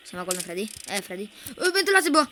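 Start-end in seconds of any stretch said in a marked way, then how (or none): 1.7-2.3 alarmed shout. Real, not acted. Alarm at vent in game.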